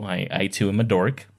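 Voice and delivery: ordinary delivery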